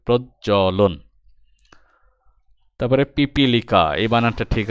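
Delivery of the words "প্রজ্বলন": প্রজ্বলন sounded out as proj-jo-lon; careful teacher's model of প্রজ্বলন; pronunciation demo. Shown as a demonstration. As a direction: say প্রজ্বলন in separate syllables. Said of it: "আমরা যেভাবে উচ্চারণ করি".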